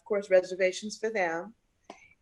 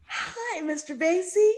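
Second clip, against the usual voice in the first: high, bright-eyed hello; eager young girl; 12 semitones higher, 4 dB louder, slower than usual. Retold or imitated. New singer greeting Mister [name] in the lobby, eager and high. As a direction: pitch it up like a nervous new girl.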